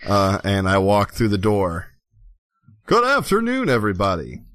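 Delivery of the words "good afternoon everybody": Laszlo, hearty greeter. Acted. Player greets bar as Laszlo.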